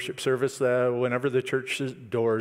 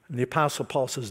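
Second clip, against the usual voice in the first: ordinary delivery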